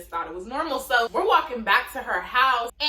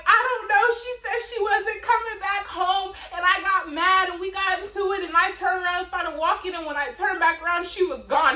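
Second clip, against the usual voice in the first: higher, flustered explaining; a hint of a scared kid explaining; panicked retelling; 6 semitones higher than usual. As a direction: blurt it out to the mom, upset. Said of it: "I was like".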